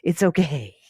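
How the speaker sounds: a hint of a small girl being grown-up about it; little girl voice. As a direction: play the kid calmly reassuring her mother. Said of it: "she keeps trying to convince the mom".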